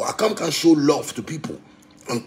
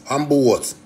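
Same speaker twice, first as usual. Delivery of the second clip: normal talking voice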